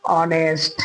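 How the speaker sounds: ऑनेस्ट low, opening on the O vowel; O at the start. Shown as a demonstration. Teacher shows how Hindi writes it, ऑनेस्ट beginning with O. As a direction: say ऑनेस्ट without any H. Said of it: "यानी कि ओ आ रहा है".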